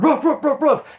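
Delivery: high, loud barking noises; imitated dog barks. Recalling the chase, loud high mock dog barks. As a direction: bark like the police dogs.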